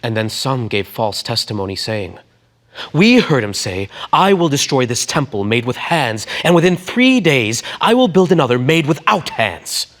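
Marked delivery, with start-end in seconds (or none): 2.9-9.9 raised, higher accusing voice; loud accusation. Acted. False witnesses accusing Jesus, loud and higher. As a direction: denounce him before the council.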